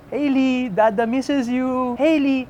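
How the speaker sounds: put-on high, drawn-out singsong to a toddler; doting dad calling. Dad calling his two-year-old from a shoot, doting and wistful. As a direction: coax a small child's attention, voice lifted. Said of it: "tatawagan ko si Hailey".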